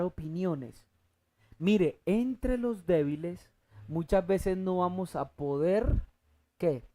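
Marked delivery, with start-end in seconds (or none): none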